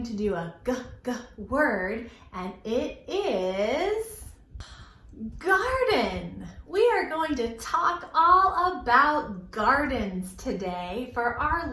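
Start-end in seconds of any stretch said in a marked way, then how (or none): none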